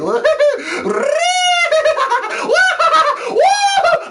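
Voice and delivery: very high, loud squealing laugh; shrieking laugh. Pretend. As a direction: squeal with glee like a kid in the game. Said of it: "I wanna be up in this room going like".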